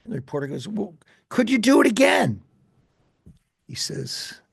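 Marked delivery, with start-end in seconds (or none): 0.7-2.4 high, loud call from the crowd; shouted question. Retold or imitated. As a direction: shout it across to him. Reporter repeats his question.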